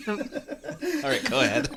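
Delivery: high, laughing through it. Real, not acted. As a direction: crack up while waving on. Laughing at bad line.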